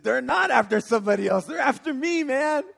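loud, rising cry of realization; alarmed shout. Retold or imitated. Driver realizing police want him, alarmed shout.

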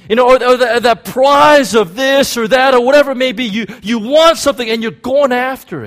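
high, loud, driving preacher voice; full-force preaching. Acted. Pastor urging the congregation at full volume, high and loud. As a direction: preach it hard to the crowd.

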